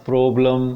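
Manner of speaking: PROBLEM said with a long "oh", "prohblem"; wrong o vowel. Shown as a demonstration. Teacher warning learners off a mistake, PROBLEM as "prohblem". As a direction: round PROBLEM's first vowel to "oh". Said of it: "This is not problem".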